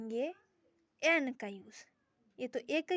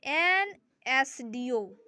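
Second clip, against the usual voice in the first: एन एस डी ओ spelled very slowly; slow example; 5 dB louder, much slower than usual. Shown as a demonstration. Teacher announcing the next example. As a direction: drag out each letter. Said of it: "अब देखिए".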